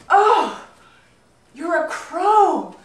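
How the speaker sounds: loud high gasp of surprise; a loud, high, slow "you're a crow"; startled. Acted. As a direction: jump at the sight. Young man startled by transformed goose.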